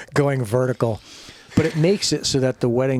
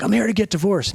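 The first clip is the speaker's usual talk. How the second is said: higher-pitched retold indignation; pissed-off announcement; 8 semitones higher than usual. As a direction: announce it with mock irritation. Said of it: "I'm still fucking pissed".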